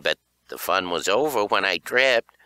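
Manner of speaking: ordinary delivery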